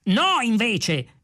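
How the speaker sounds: high, drawn-out contradiction; exasperated exclamation; irritated. A narrator's reading. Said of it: "esclamò irritato Magic Tice".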